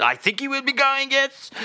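very high mock-French reply. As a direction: answer eagerly in a high French voice.

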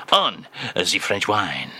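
normal talking voice

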